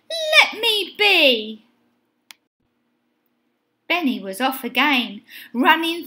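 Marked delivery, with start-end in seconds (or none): 0.1-1.6 high, slow, drawn-out plea; shout. A narrator's reading. Benny to the cows after him, high drawn-out shout. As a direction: cry it out, stretching each word. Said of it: "shouted Benny".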